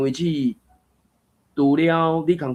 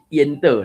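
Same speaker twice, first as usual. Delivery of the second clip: 烟 hit louder in 烟的; stress on 烟; 4 semitones higher, 6 dB louder than usual. Shown as a demonstration. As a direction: lean on 烟 as the key action word. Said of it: "甲烟这个当作一个重要的动作". (「烟的」重音囥佇「烟」)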